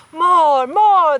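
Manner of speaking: loud, high cheering shout. A narrator's reading. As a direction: cheer it out from the crowd. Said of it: "called out".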